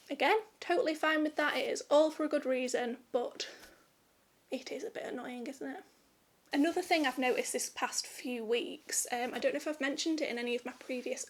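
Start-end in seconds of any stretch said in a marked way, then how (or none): 4.5-5.8 hushed aside, barely voiced; whispering. Real, not acted. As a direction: drop to a whisper. Whispered aside about being annoyed.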